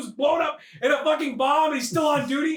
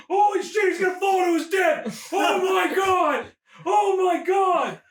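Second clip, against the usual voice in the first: high, escalating exclamations ending drawn out; mock panic; 5 semitones higher, slower than usual. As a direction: wail in comic horror as he drops. Acted panic at the fall.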